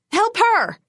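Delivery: high, loud urgent yell; yelled plea. A narrator's reading. Huntress yelling to Bones to save the girl, urgent yell. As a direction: scream for help across the bar. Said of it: "I yelled to Bones".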